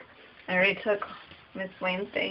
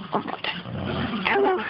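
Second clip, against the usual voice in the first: pet-talk coax; much slower than usual. Real, not acted. Coaxing dog.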